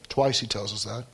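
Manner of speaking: normal talking voice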